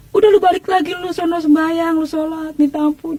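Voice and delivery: high-pitched mock nagging of a relative; retold scolding urging. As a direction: urge her back, imitating a scolding relative. Keluarga menyuruh Irma bertobat. (menirukan keluarga yang mendesak bertobat)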